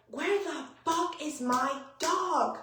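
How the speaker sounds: slow, high wail of panic, held back; mock screaming. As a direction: imitate a frantic scream without the volume. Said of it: "just screaming and screaming".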